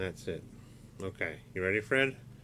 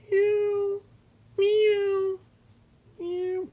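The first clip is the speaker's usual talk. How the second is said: very high, louder squeaky meow; a monkey puppet pretending to be a cat; 8 dB louder, much slower than usual. Fred the puppet meows instead of monkey sounds, very high squeak.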